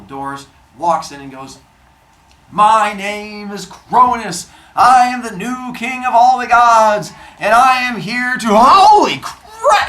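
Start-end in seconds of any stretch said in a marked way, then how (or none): 2.5-8.5 loud, slow booming announcement; grand proclamation. Acted. Cronus announces himself to his brothers, loud and slow. 8.5-9.9 high, loud, drawn-out yell of shock; shocked shout. Acted. Cronus sees his brothers in Tartarus, a loud shocked yell.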